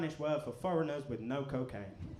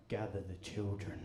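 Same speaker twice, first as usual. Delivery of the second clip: headmaster voice; 5 semitones lower, 3 dB quieter than usual. Retold or imitated.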